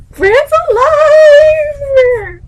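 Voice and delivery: very high, long, loud squeal; excited scream. Real, not acted. Reader celebrating Bran's survival, long ecstatic scream. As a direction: shriek it and hold the last word. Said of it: "when I get excited".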